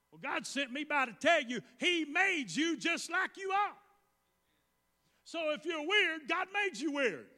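high-pitched, insistent preacher delivery; fervent preaching. Real, not acted. Preacher telling those who feel weird God made them, fervent preaching. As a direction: proclaim it high and urgent.

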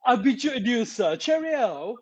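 badly faked, hammed-up British accent; failed British accent. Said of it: "do some British accent, but no, we'll fail".

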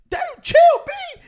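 very high, loud, drawn-out plea; exasperated retort. Retold or imitated. Telling an angry man in line to chill, high exasperated yelp. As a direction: stretch it out in a comic high yelp.

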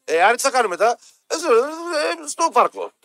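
mock someone hard to understand; unintelligible speech. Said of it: "δεν είναι αυτό το ακατάληπτο".

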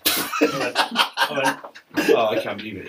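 laughing through his own quote; half-laughed disbelief, still giggling. Real, not acted. Retelling the manager's call, laughing as he goes.

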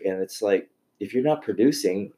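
normal talking voice

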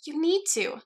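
high-pitched urging; insist, leaning on the key word; insistent. A narrator's reading. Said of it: "Alice insisted".

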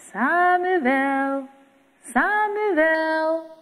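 high, drawn-out calling voice from afar; God calling. A narrator's reading. God calls sleeping Samuel in the night, distant and sung. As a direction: call the boy's name like a distant sung summons. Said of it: "Iemand roept hem".

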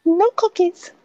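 put-on high prissy little girl voice; Girl Scout voice. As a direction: play the indignant cookie seller. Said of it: "That's a Girl Scout cookie voice".

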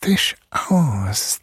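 whispered hiss of a monster; whispering mouse king. A narrator's reading. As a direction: hiss it threateningly. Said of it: "zischte er".

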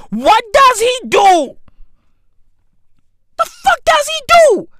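high, loud demand in disbelief; exasperated shout. Real, not acted. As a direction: shout the question at the screen. Ranting about the job title.

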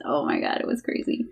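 breathless whisper. Real, not acted. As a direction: whisper it in disbelief. Recalling Florida.

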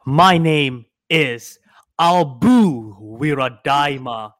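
slow, loud horror host welcome; slow spooky host. Acted. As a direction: drag out the welcome, menacing.

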